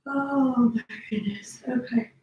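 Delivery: words stretched through a real yawn; yawning mid-sentence. Real, not acted. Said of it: "I'm sorry I'm yawning".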